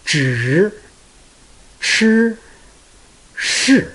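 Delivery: zhi shi shi in their three tones; Chinese tones. Shown as a demonstration. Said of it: "Once you pronounce this word".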